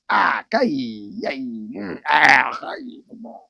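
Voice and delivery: exaggerated disgusted ahs and mms; mock disgusted exclamations. Imitating gossiping Northerners, disgusted ahs and mms.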